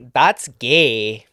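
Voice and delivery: low attempt at Dutch that slips into German; botched Dutch accent. Shown as a demonstration. Comedian attempting a Dutch accent, slipping into a German one. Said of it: "No, that's German".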